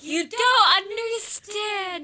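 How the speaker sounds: mock protest. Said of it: "and then we were like".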